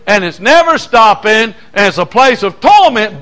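slow, rising preacher's chant, each line pushed harder; preaching refrain. Acted. Preacher driving the refrain at his congregation, building. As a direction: hammer the repetition home like a revival preacher.

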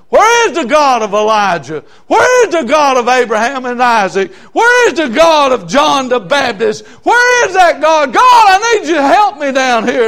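high, loud, anguished cry to God; crying and hollering. Retold or imitated. Preacher reliving his cry to God in the woods, hollering. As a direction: holler it out, voice cracking. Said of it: "I got to crying and hollering".

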